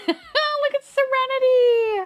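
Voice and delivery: laughing, very high drawn-out squeal; high-pitched triumphant delight; elated squeal. Real, not acted. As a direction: squeal it slowly through laughter. Her guess proves right.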